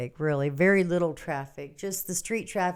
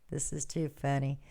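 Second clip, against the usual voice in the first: usual speaking voice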